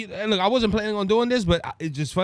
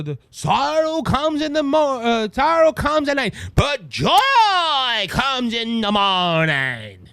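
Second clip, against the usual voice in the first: put-on preacher-style accent, loud and high; exaggerated fake accent, drawn out like a revival speech; Kamala Harris; 4 semitones higher, 5 dB louder, slower than usual. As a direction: belt out a mock church accent, stretching JOY. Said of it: "look at all the accents she gives".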